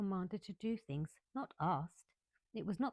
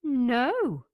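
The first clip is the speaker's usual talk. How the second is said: a loud, high indignant no; offended girl refusing the maid, loud and high; 6 semitones higher, 13 dB louder than usual. Acted. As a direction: snap it out, insulted. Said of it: "answered Mary quite indignantly".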